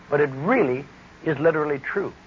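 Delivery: ordinary talk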